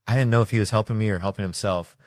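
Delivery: ordinary talk, nothing marked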